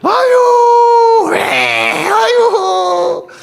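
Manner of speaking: slow, loud, high wailing cry; mock wail. Pastor acts a fed-up wife wailing, loud and drawn out.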